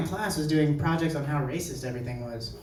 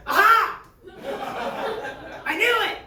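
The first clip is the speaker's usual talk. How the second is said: triumphant outburst. Acted. Classmate hearing the answer India, hammed-up triumphant outburst. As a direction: burst out as if proven right.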